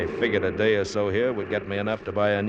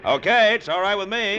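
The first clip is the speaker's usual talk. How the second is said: pitched up, calling over noise; raised over engine; 10 semitones higher, 4 dB louder than usual. Acted. Pilot answering Bill over the motor, raised voice. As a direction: lift it over the propeller roar.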